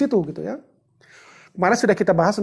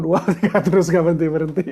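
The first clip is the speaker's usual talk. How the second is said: quick words through laughter; laughing while counting; 5 dB louder, faster than usual. Real, not acted. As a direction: giggle through the joke. Joke about counting for wife. (sambil tertawa, cepat)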